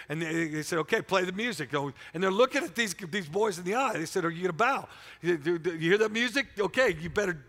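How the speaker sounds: loud, high, fervent preaching; a preacher roaring the story of the furnace; full-force preaching. Acted. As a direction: preach it hard to the crowd.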